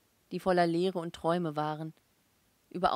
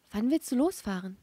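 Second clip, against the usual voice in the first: higher, louder agitated question; trembling; 4 semitones higher, 6 dB louder than usual. A narrator's reading. Wife asking her husband about leaving, trembling. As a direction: ask with a touch of trembling. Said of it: "fragte sie zitternd".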